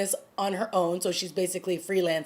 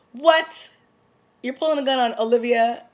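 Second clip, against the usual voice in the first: higher and louder, what? you're pulling a gun on Olivia?; outraged disbelief; 5 semitones higher, 6 dB louder than usual. Real, not acted. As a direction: challenge the character in real shock.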